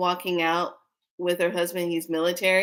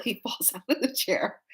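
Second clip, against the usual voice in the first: ordinary delivery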